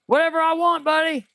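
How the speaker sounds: high cocky voice for the alternator; alternator's voice. Pretend. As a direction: toss it off high and cheeky. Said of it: "the alternator saying".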